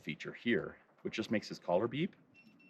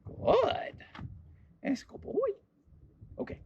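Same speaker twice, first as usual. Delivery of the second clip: much higher sing-song praise; high dog praise voice; much slower than usual. Real, not acted. Owner rewarding her dog, high sing-song praise. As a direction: gush over him in a high voice.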